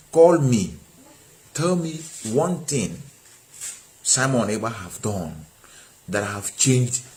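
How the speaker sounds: soft, low, deliberate calm; measured, hushed challenge with long pauses; very calm, slow repetition. Real, not acted. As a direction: repeat it slowly and softly, spacing out each phrase. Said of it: "Let me say it in a very calm voice".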